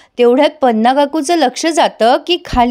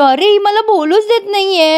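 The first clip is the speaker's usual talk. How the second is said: exasperated complaint; 8 semitones higher than usual. Retold or imitated. Husband complains he can't speak.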